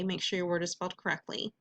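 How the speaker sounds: ordinary talk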